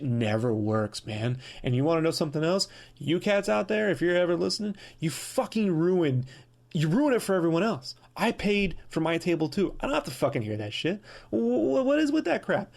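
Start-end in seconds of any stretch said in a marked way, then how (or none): 3.0-12.7 rising, fed-up rant at booth sellers; exasperated rant. Real, not acted. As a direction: vent at them, building to outrage.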